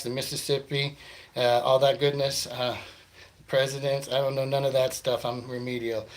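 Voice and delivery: normal talking voice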